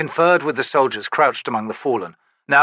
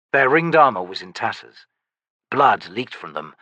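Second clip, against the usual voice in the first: normal talking voice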